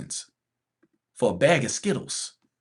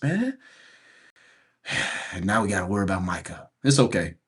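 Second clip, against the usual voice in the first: ordinary delivery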